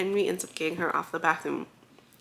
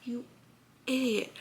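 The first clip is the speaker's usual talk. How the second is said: exasperated muttering; 4 semitones higher, 7 dB quieter than usual. Real, not acted. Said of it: "this whole time I'm just like".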